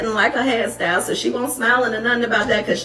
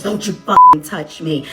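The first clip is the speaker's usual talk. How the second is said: slow, louder threatening snarl; mock angry mom; 5 dB louder, slower than usual. Imitating the mom pushing her daughter back, slow threat. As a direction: lean on every word like a threat.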